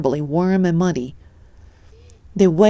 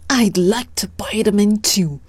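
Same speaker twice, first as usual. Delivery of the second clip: snarl; 2 semitones higher than usual. A narrator's reading. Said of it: "snarled Uncle Pike".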